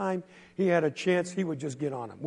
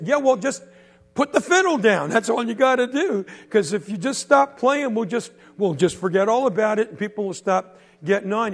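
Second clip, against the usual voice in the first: loud, higher sneering push; jeering bully; 4 semitones higher, 5 dB louder than usual. Acted. Bully pressing the boy to quit fiddle, loud sneer. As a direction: keep poking at him, louder.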